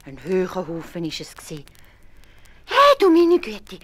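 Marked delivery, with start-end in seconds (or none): 2.7-3.8 high, loud, slow astonished cry; astonished wife. A narrator's reading. Wife seeing the heap of gold, astonished cry. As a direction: gasp it out in amazement. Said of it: "hat die Frau gerufen".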